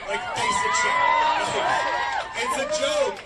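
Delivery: high, loud exasperated yell; outraged cry. Real, not acted. Speaker outraged at missing healthcare, loud. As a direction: scoff it out in outrage.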